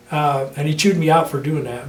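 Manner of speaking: ordinary talk, nothing marked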